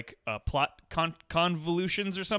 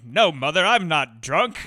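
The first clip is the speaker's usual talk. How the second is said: put-on louder old Hollywood star, insisting he's sober; Cary Grant impression; 5 dB louder, slower than usual. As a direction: play the tipsy gentleman acting sober. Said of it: "We're all doing great Cary Grant impressions here".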